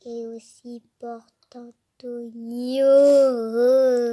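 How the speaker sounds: slow, drawn-out careful phrase; said slowly on request. Shown as a demonstration. Child asked by her parent to say it slowly, slow and careful. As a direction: stretch every syllable of Et aussi Porto Antonio. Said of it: "Say it slow".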